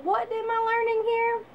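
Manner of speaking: high, soft, baffled ask; lifted, hushed disbelief; baffled question. Retold or imitated. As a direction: wonder aloud at the pointless lesson. Mocking her own rote memorizing.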